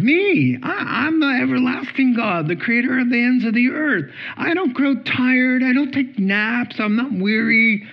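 high, emphatic declaration of majesty; acted divine rebuke, pitched well up; indignant God. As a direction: remind them forcefully who you are. Voices God rebuking his people.

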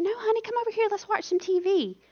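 very high, coaxing sing-song mom voice; imitated anxious mother. Mother keeping her daughter indoors, high coaxing mock voice. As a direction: coax a child in an exaggerated high pitch.

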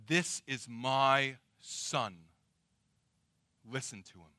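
very slow, low, weighty pronouncement; commanding father. Acted. God the Father orders disciples to heed his son, solemn command. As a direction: draw it out, dropping softly on the last phrase. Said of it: "this sense of command".

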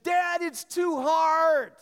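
put-on high, loud, drawn-out kid whine; whining child. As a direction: wail in protest like a worn-out child. Child whining to her dad.

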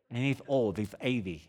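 lisped s, as if fat-tongued; thick-tongued imitation; mock lisp. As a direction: keep up the put-on lisp. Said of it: "He probably has a very serious lisp".

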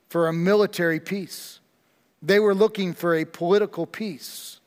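ordinary delivery

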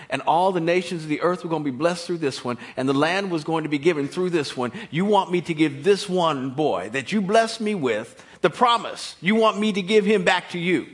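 loud, incredulous plea in preaching voice; impassioned preaching. Acted. Preacher voices a man questioning God's demand, impassioned. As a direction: preach it loud, pressing each phrase. Preacher pleads as Abraham to God.

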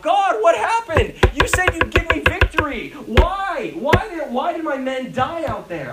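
high, loud anguished cry to God. Retold or imitated. Joshua crying out to God after defeat, anguished. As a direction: cry out to God in confusion, slowly at first. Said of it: "And he starts crying out".

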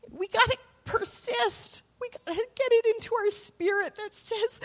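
full-voiced sermon exhortation; preaching. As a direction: lift it up and urge the congregation on. Preaching climax.

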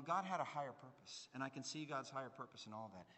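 usual speaking voice

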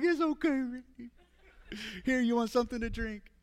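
very high-pitched, hammed-up soothing voice; mock comforting. Comforting a crying friend on the trail, high mock soothing. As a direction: comically coo to a crying friend. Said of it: "hugs me and starts crying".

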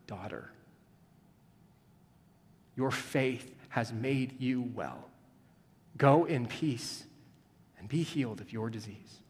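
hushed and loving; tender. Acted. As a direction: say it gently, as a blessing. Jesus speaks tenderly to woman.

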